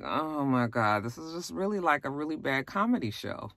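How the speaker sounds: mock-weary reaction to the ski mishaps; exasperated aside. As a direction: let the disbelief sink it. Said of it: "I was just like".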